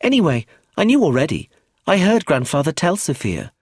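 put-on high child voice, a touch bored. A narrator's reading. Child already knew about the money, bored. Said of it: "sounding bored".

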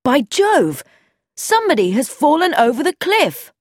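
high, loud shocked cry; shocked exclamation. A narrator's reading. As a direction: blurt out the horror. Discovering a fallen man.

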